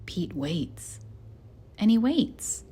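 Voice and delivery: plain reading voice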